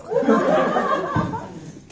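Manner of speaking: high burst of laughter; loud laugh. Real, not acted. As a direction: break into laughter. Laughs after the quote.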